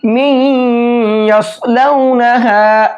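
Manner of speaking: می یصلونہا recited very slowly with a nasal hum at the merge; idgham with ghunna. Shown as a demonstration. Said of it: "اس طرح سے پڑھیں گے". (ادغام اور غنہ کے ساتھ آہستہ تلاوت)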